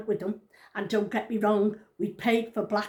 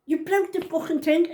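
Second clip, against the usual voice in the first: retold angry outburst; 6 semitones higher, 3 dB louder than usual. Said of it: "I'm going".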